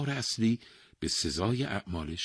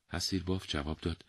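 plain reading voice

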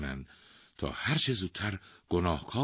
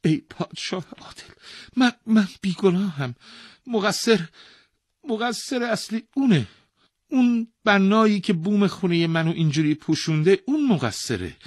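high, loud desperate begging; poor man wailing his innocence to the king; pleading and wailing; 10 dB louder than usual. Acted. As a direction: plead for your life, wailing. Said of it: "با عجز و ناله گفت".

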